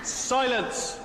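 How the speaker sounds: high, commanding call for silence; imperious command. Acted. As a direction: call the hall sharply to order. Imperiously calls the hall to silence.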